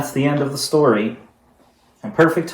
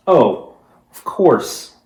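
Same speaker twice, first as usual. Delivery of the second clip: sudden realization; 7 dB louder, much slower than usual. Acted. Sudden idea after the story.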